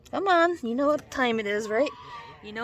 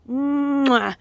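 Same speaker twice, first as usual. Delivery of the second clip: smacking kiss sound; 4 semitones higher, 4 dB louder than usual. Real, not acted. Kisses the goose again.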